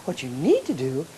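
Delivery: very soft, tempting little voice; coaxing inner voice. Acted. Little voice talking a tired student into sleep, coaxing. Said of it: "The voice says".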